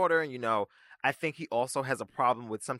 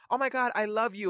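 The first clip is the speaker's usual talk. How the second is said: high, gushing "Oh my God, I love you"; gushing fan; 9 semitones higher than usual. Pretend. A fan spotting Nene Leakes on the street, gushing high. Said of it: "people will see... Nene Leakes on the street".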